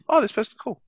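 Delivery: high, bright delighted discovery; pleasantly surprised. Real, not acted. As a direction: react to finding someone cool, voice jumping up. Finds a new user online.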